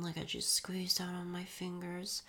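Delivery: ordinary talk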